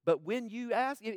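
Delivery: usual speaking voice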